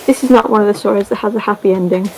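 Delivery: ordinary delivery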